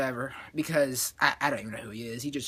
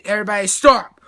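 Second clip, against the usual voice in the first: acted command; 9 dB louder than usual. Acting command before fight.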